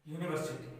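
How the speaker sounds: यूनिवर्सिटी said clearly with a yoo sound at the start; teacher modelling the word; pronunciation demo. Shown as a demonstration. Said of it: "Hindi में".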